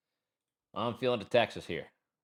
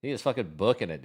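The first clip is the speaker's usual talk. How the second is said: ordinary delivery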